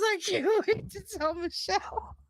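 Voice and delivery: quoted line broken by laughter, quick; laughing while retelling. Retold or imitated. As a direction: giggle through the quote.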